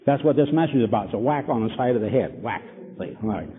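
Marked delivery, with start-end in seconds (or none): none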